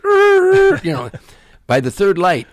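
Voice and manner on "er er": loud, high-pitched skid noise; screeching tires. Retold or imitated. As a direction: imitate brakes squealing through an intersection. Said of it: "cars screeching all over the place".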